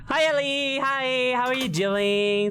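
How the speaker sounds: high, bright excited hello; excited greeting. Real, not acted. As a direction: greet a friend with a squeal. Greeting a raiding streamer.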